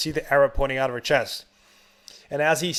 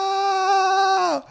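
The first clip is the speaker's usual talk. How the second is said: long, high, drawn-out NO; hammed-up movie wail, held long; mock anguished scream; 4 dB louder than usual. Husband seeing his wife blow up, hammed-up long scream. Hero sees wife blown up.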